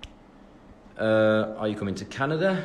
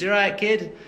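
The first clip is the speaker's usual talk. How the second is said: put-on Leeds accent; 8 semitones higher than usual. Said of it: "When I'm round Louis, it's".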